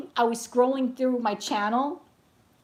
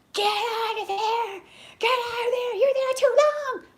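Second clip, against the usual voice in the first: much higher, slow mock shriek through a laugh; imitating a screaming old lady; 3 dB louder than usual. Old lady banging on the bathroom door, mock shriek. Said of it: "starts banging on the bathroom door like a psycho".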